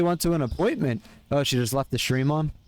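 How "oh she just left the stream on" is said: ordinary delivery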